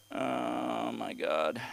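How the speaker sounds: ordinary delivery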